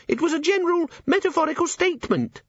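very high, squeaky correction; pocket imp. Acted. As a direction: protest like a tiny creature.

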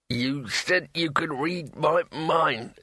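slow, mumbled and strained; indistinct. A narrator's reading. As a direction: force the words out half-garbled. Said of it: "he said indistinctly".

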